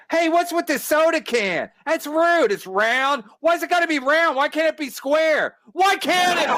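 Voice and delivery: very high, hammed-up outraged rant; Bill Burr. Mocking a comic who is mad about nothing, high hammed-up shouted rant. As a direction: escalate into a strained mock shout over nothing. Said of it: "he's acts like he's mad".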